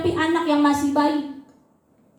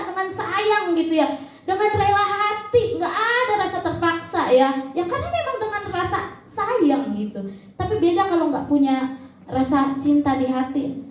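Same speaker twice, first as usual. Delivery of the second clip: ordinary talk, nothing marked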